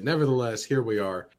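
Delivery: ordinary talk, nothing marked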